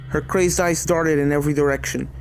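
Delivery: ordinary delivery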